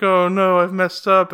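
imitating Lisa, a touch dramatic; hammed-up distraught girlfriend; mock Lisa. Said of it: "Lisa's cradling him like".

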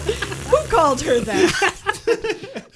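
slow, stretched out through laughter; can't get the words out for laughing; cracking up in disbelief. Real, not acted. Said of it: "Oh, dear God".

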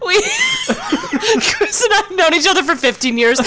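laughing. Real, not acted. Laughing through line.

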